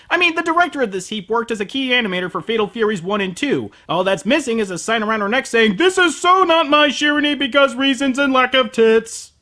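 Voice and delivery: ordinary talk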